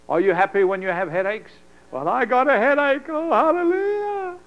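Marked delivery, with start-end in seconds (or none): 1.9-4.4 hammed-up whimper of a self-pitying sick person; mock sick complainer. Preacher mocking a church member happy with a headache, whiny mock cry.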